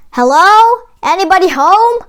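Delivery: calling out. Acted. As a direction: call out into an empty room. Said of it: "Jack called out".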